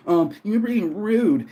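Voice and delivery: high, slow mock-prissy voice; mocking a commenter who called him rude; mocking girl. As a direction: drag it out up high, mocking her. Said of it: "This she, her girl goes".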